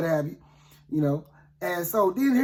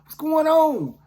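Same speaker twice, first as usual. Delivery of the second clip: high-pitched mock holler; acted cry after people walking away; hollering for help; 5 semitones higher than usual. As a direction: call up out of a pit. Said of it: "I'm hollering".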